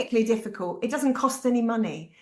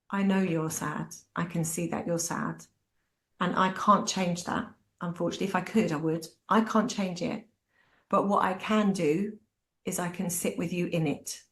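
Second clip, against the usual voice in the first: gentle comfort; 3 semitones lower than usual. Retold or imitated. Comforting sad son.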